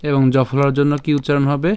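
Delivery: normal talking voice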